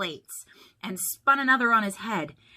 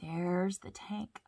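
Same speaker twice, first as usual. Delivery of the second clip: ordinary delivery, nothing marked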